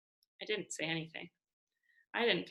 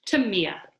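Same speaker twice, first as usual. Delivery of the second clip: group toast; 7 dB louder than usual. Acted. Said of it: "the room boomed".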